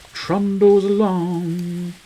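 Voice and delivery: TRUNDLES ALONG stretched slowly, held high; drawn-out repeat. Pretend. Gamer musing on how time passes, drawn-out high repeat.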